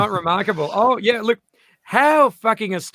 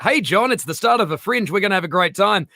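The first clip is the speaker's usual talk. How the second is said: ordinary talk, nothing marked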